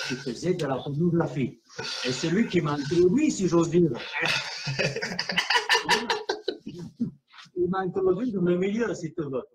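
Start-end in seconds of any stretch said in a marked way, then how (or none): 4.0-7.1 breathy laugh. Real, not acted. Laughs at own innuendo.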